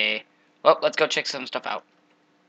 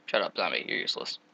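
whispered insult; 8 semitones lower, 10 dB quieter than usual. Real, not acted. Muttering at zombie.

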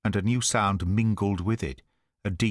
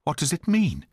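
higher-pitched, alarmed questioning; gasped alarm; 6 semitones higher than usual. A narrator's reading. Asking Holmes about the scream on the moor, gasped alarm. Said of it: "I gasped".

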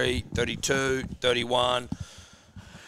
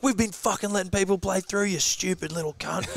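mock furious dad; 6 semitones higher than usual. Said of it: "He's like".

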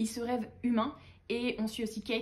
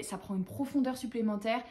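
normal talking voice

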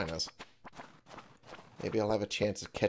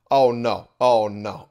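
very loud, sudden dismay; alarmed cry; 2 semitones higher, 14 dB louder, slower than usual. Real, not acted. Player realising time ran out, loud alarm.

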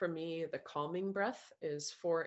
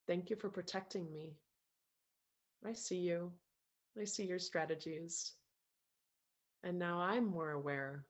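calm meditation voice, softer than chat, with long pauses; guided meditation; 4 dB quieter than usual. Acted. Coach guiding a group through breaths and self-love, calm meditation voice.

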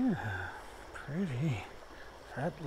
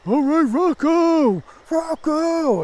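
loud, high, drawn-out street shout; Italian guy yelling Rocco; 12 semitones higher, 11 dB louder, much slower than usual. Retold or imitated. Fan outside a movie theater yelling Rocco, loud and drawn out. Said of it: "the Italian guy was going".

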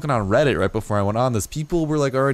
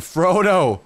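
excited call; 8 semitones higher, 4 dB louder than usual. Real, not acted. Greets his new dodo.

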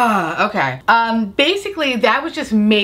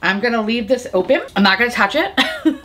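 ordinary talk

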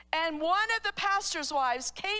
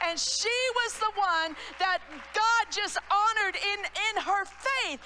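excited testimony; 6 semitones higher, 3 dB louder than usual. Retold or imitated. Excited healing testimony climax.

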